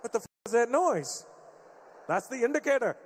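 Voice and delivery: usual speaking voice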